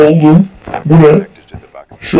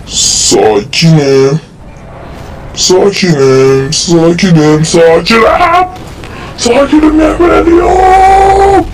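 building to a shout; slower than usual. Real, not acted. Gamer swearing he is calm, building to a yell. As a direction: insist you're calm while losing it, ending in a yell. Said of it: "Ben cidden sakinim".

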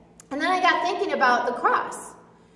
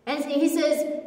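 usual speaking voice

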